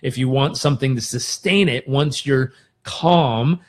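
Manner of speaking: ordinary talk, nothing marked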